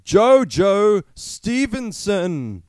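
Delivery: announcer hype. Pretend. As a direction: drag the name out like a grand introduction. Hyping the new contender's name.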